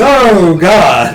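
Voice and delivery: enthusiastic. Acted. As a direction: cry it out eagerly. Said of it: "Enthusiastically helping you".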